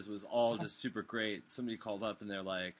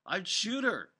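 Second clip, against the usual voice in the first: mock ranting; 8 semitones higher, 4 dB louder than usual. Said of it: "I was like".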